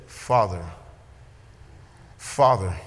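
low, intimate call to the Father; Jesus praying, a low tender "Father"; tender prayer. Acted.

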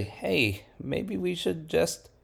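nervous people propose retreat; hesitant high plea; mock suggestion. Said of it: "And the people say".

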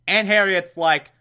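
usual speaking voice

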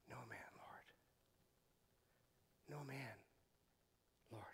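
faint unvoiced whisper; a woman too frightened to speak, whispering to Jesus; barely a whisper. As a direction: scrape the words out in a whisper, barely audible. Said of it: "she can barely get a whisper out".

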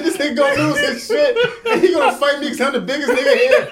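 high, loud comic rant; retold panic. Man retells dreading a fight with stranger, loud comic panic. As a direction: rant in mock dread, half laughing.

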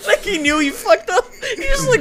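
high, loud laughing words. Real, not acted. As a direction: blurt it out through laughter. Laughing hard while telling.

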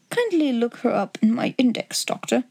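ordinary delivery, nothing marked

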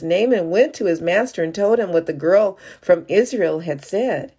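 ordinary talk

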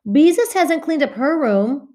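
high, slow, tattletale whine; tattling child. Acted. Ramona telling on her sister to her mother, with a smug whine. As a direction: point it out like a smug little sister. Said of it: "could not resist pointing".

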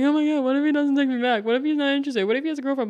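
high, fast fretting; playful anxious spiral; mock panicked worrying. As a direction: rattle off the worries in a high pitch. Acting out her worries about texting.

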